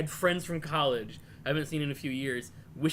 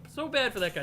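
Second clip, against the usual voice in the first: ordinary talk, nothing marked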